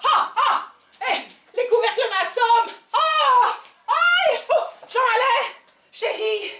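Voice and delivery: high loud yelps of pain; mock cries of pain. Cook beaten by saucepans, high loud yelps. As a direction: yelp as the pans hit you.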